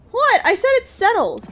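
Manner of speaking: high, loud insistence; insisting loudly. Acted. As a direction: shout that it's settled.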